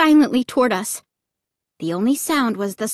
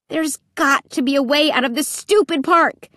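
angry cry; 5 semitones higher than usual. A narrator's reading. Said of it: "I cried angrily".

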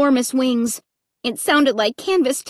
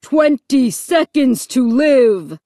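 slow, loud menacing announcement; booming loudspeaker; 4 dB louder, slower than usual. A narrator's reading. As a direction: drag out the countdown over the speaker. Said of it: "the voice on the loudspeaker boomed".

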